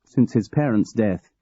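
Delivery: ordinary delivery, nothing marked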